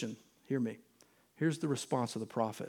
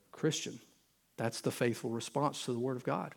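usual speaking voice

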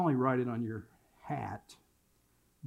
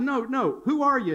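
high, louder, pressing correction; insistent, raised-pitch challenge; insistent dad quizzing; 10 semitones higher, 5 dB louder than usual. Retold or imitated. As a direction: cut in and push for the right answer. Acted father correcting his son.

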